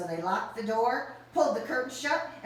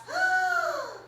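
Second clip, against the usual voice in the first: high gasp; 4 dB louder than usual. Acted.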